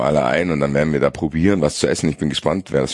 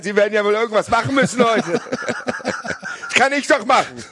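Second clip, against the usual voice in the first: mock eager neighbour. Mock eager neighbour offering help.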